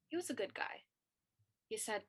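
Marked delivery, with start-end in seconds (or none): none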